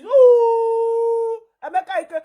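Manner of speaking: high, loud drawn-out howl of a name; mock howling shout; wolf-like howl. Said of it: "they shout like this".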